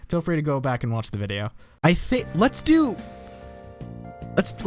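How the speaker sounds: ordinary delivery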